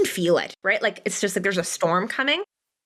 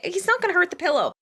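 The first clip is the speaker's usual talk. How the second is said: amused shrug; 10 semitones higher than usual. Retold or imitated. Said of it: "I'm like".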